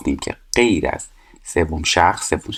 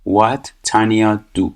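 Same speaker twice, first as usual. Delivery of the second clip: very slow and drawn out; slow teacher reading the exercise; slow read-aloud; 3 semitones higher, much slower than usual. Shown as a demonstration. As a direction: say the gapped line slowly for the class. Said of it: "reading the gapped line".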